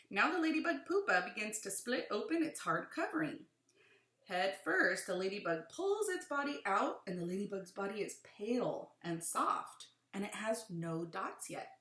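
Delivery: animated read-aloud with rising and falling emphasis; clear, lilting picture-book narration; reading to children. Acted. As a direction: read it to a class, punching key words.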